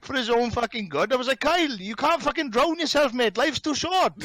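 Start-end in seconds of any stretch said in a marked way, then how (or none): 1.4-4.1 high-pitched, animated pleading; retold exasperated plea. Friend tells Kyle not to drown himself, high exasperated plea. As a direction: re-enact pleading with a mate.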